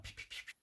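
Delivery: breathy whispered gunshot noises; whispered pew pew. Retold or imitated. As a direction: mouth the laser shots under your breath. Mimicking gunfire in a firefight.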